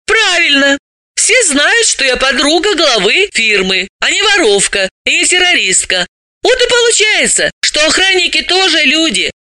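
very high, lively character voice; high-voiced, pointed explanation; animated female character, triumphant argument. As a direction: argue the point with pitch pushed high. Voices Dalila making her point.

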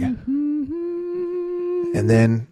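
sudden high squeak; mock voice cracking high; squeaky high voice. Hammed-up tragic story.

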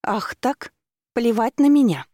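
high, rising outburst, loud at the end; angry cry. A narrator's reading. Ignored woman to the driver, crying out angrily. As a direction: cry out, wildly offended. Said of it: "женщина выдала странный звук и закричала".

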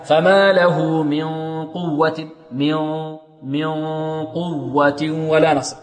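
slow recitation, ن of من veiled in a nasal hum; chanted من قوة, the hidden ن taking the heaviness of ق; ikhfa demo. Shown as a demonstration. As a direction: hold من as a hum coloured by the ق. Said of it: "هكذا".

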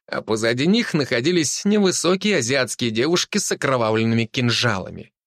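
plain reading voice

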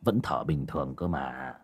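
put-on low, soft, hesitant old man; father checking whether his son still breathes; worried old father. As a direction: murmur unsure, listening for breath.

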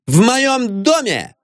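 slow, high indignant declaration; stern, drawn-out high warning; menacing woman. A narrator's reading. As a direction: lay down the law in a high wifely voice. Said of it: "сказала она грозно".

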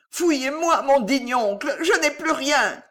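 choked nephew's high panicked plea; terrified howl. A narrator's reading. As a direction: shriek it while being choked. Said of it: "Le hurlement de Gillot".

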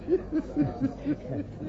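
high derisive burst of laughter; scornful laugh. Acted. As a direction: laugh off the absurd wording. Colleague scoffs at the statement.

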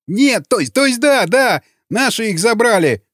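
high-pitched, stumbling correction; panicked answer. A narrator's reading. Beaten prisoner answers raider, panicked. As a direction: blurt and correct yourself in a high, scared voice.

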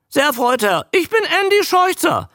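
very high, loud squawky creature; loud, high, quick croak; croaking newt. A narrator's reading. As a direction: introduce yourself as an eager talking newt. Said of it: "krächzte er".

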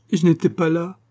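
a hint of sobs as the daughter mourns her father; sobbing. A narrator's reading. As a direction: let the grief break through the words. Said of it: "dont les sanglots redoublèrent".

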